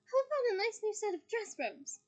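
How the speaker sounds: high doting mum voice; fond mother. Acted. Mother offering Ron new robes fondly. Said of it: "said Missus Weasley fondly".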